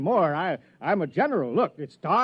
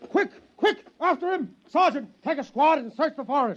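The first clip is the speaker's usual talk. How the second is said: much higher, loud frantic commands; urgent shouted orders; 10 semitones higher, 4 dB louder than usual. Acted. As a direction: yell at the troops to give chase. Inspector orders the chase.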